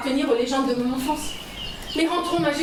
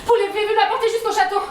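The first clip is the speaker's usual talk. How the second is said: urgent pleading; 6 semitones higher than usual. Acted. Begging stranger for help.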